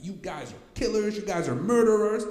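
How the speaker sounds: imitating angry accusation. Patient yelling at hospital staff, imitated high and loud. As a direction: hurl the accusation at the doctors. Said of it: "He comes out and he's angry".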